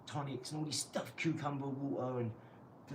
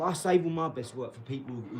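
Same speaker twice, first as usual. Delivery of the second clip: normal talking voice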